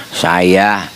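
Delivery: low, weak sick man answering; a lightly voiced sick man from inside. (suara orang sakit yang lemah dan rendah)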